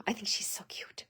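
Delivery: whispered aside. Real, not acted. Aside about the moose card.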